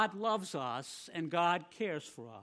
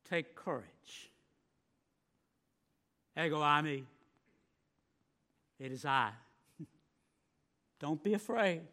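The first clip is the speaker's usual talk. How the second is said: tender reassurance; 5 semitones lower, 4 dB quieter than usual. Acted. Jesus comforting his followers softly on the water. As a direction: soothe them like a frightened child.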